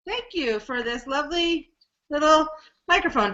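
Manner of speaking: ordinary talk, nothing marked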